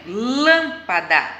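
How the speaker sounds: "lâmpada" drawn out, "lâm" strongest; stress on LÂM. Shown as a demonstration. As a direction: stretch "lâmpada" by syllables, leaning on "lâm". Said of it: "O lã é a sílaba mais forte". ("lâmpada" devagar, com "lâm" mais forte)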